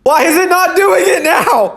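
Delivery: high, loud and slow, fed up with the phone; exasperated. Real, not acted. Talking to his phone as the trick fails, exasperated and high. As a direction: plead with the broken camera in disbelief. Phone won't glitch on cue.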